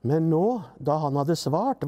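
ordinary delivery

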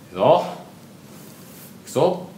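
rising excitement before a dive; psyching up. Real, not acted. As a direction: build up to the jump. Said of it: "いきますよ。". (ベッドダイブ前の気合い)

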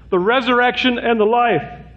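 "resurrection" preached high and urgent; fiery preaching. Real, not acted. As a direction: lift "the resurrection and the life" high with full force. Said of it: "I'm the resurrection and the life".

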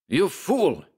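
high furious German outburst; furious German scientist. Acted. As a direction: hurl it like an oath. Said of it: "bombarded me with German oaths".

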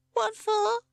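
very high, loud chick voice; nervous squeak. A narrator's reading. Captive chick to the snake, nervous squeak. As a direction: squeak the question anxiously. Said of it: "inquired his captive nervously".

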